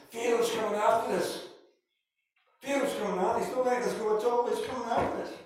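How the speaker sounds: frantic soldier crying the alarm; panicked warning. Acted. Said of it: "rear guard comes up and says".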